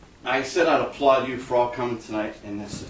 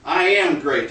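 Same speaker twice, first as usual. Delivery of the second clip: loud, raised call; loud demo; 5 semitones higher, 10 dB louder, slower than usual. Shown as a demonstration. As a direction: call it out with energy. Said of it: "Now I want you to say".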